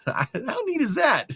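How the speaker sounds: laughing. Real, not acted. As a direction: laugh through the question. Laughing at own joke.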